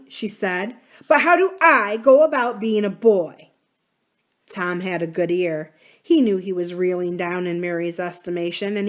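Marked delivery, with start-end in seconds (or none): 1.1-3.5 put-on louder, insistent little-girl voice; demanding young girl. Mary pressing Uncle Tom on becoming a boy, loud and insistent. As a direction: push him for an answer.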